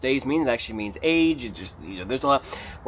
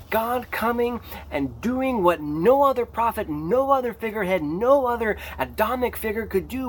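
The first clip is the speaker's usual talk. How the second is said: impassioned preaching; 8 semitones higher than usual. Real, not acted. Preacher proclaiming Jesus as the ultimate sign, impassioned. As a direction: preach it with rising fervor.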